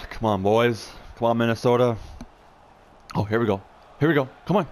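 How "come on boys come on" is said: ordinary delivery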